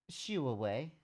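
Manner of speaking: ordinary delivery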